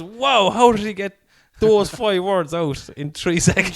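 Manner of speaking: high, loud, slow astonishment; amazed. Retold or imitated. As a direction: marvel out loud, drawn out. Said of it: "everyone just goes".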